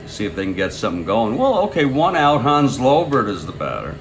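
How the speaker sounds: ordinary talk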